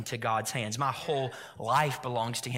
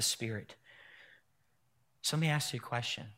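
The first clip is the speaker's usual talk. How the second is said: quiet reflective preaching; 3 semitones lower, 4 dB quieter than usual. Acted. Pastor inviting the congregation to imagine peace, softly. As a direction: draw them in gently, half-hushed.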